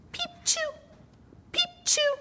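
very high, soft tiny peep; tiny, soft high peep-sneeze; sneezing chick. A narrator's reading. Narrator as the newborn chick, soft peeps.

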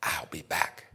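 hushed, low whisper; whispered threat from Death; Death's whisper. Acted. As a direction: breathe it low and menacing. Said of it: "See, just like that".

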